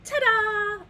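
loud, high, drawn-out fanfare; triumphant ta-da. A narrator's reading. Storyteller opens Toucan's party tale with a loud, triumphant flourish. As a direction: announce it with a big flourish.

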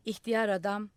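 plain reading voice

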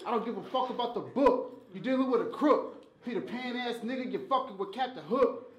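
usual speaking voice

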